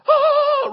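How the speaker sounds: loud, high celebratory "ahh"; drawn-out cry of victory; excited scream. Retold or imitated. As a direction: whoop as if the answer won. Crowd reaction to winning answer.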